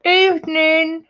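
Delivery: talking around a pen held in the mouth; pen in teeth. Real, not acted. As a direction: say it with a pen between your teeth. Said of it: "I've got a pen in my mouth".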